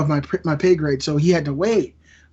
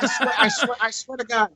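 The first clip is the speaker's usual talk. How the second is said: laughing protest; 5 semitones higher than usual. Real, not acted. Pleading innocence amid laughter.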